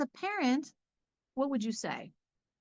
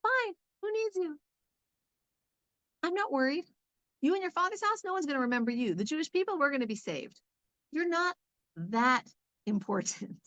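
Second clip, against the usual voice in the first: high, breezy brush-off; dismissive Mordecai; 5 semitones higher than usual. Retold or imitated. Mordecai brushing Esther off, mock dismissive. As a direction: shrug her off as if she doesn't matter. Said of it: "He's saying".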